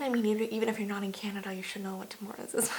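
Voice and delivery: very soft, low, half-whispered aside; hushed aside. Real, not acted. Vlogger teasing viewers who don't know the coffee shop, hushed aside. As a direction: drop to a near whisper.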